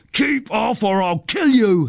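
high, drawn-out threat; angry yell. A narrator's reading. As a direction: warn him off in a high, stretched-out yell. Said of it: "he yells".

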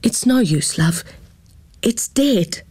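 a touch of a trembling voice; shaky voice. A narrator's reading. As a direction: gently break the news, fading to a whisper. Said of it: "Her voice shook like Gran's".

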